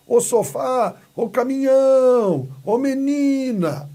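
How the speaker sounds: high, loud, long-held FÁ, NHÃO and NI in "sofá", "caminhão", "menina"; stretched calling out. Shown as a demonstration. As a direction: call from across the street, stretching the strong syllable. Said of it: "do outro lado da rua e está chamando".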